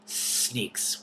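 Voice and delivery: unvoiced hissing snake voice; soft hiss. A narrator's reading. Hidden snake warning the dragons, hissed whisper. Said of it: "whispered the soft voice".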